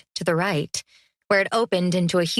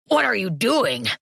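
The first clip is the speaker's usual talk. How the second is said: grumbling. A narrator's reading. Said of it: "Groton grumbled".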